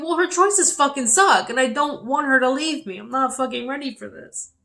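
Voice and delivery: angry outburst. Retold or imitated. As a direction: spit it out in raw anger. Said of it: "I'm like, thinking".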